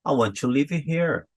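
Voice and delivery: Brazilian learner reading English from spelling; English with Brazilian "want-chu livi"; heavy Brazilian accent. Retold or imitated. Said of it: "O sotaque puxado".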